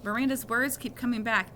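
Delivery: plain reading voice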